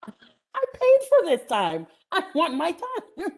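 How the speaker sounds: indignant inner protest. Retold or imitated. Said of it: "And I'm thinking".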